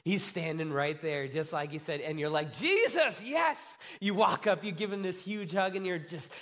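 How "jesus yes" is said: high, delighted cry of recognition; overjoyed to see a dear friend; excited greeting. Acted. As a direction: exclaim it with rising joy. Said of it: "the excitement they were all feeling".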